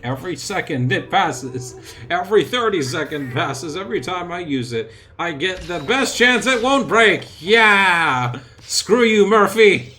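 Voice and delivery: rising to a triumphant yell; building excitement. Real, not acted. Building taunt at Murphy's Law.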